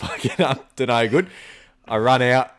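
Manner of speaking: usual speaking voice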